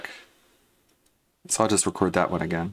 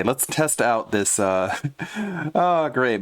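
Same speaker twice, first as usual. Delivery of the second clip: normal talking voice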